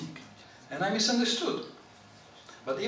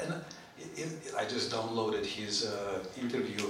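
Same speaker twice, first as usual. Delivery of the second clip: normal talking voice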